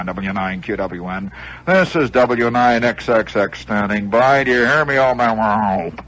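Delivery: slow, drawn-out radio call-sign delivery; famous ham operator. Retold or imitated. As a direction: stretch it out like a confident old radio hand. Plays the answering ham operator.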